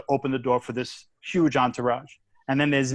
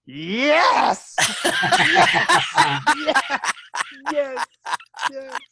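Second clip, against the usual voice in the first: laughing victory shout; 12 semitones higher, much slower than usual. Retold or imitated. Storyteller cheers at the valet after the joke lands, laughing and shouting. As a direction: celebrate wildly at the valet. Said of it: "I look over at the valet and I went".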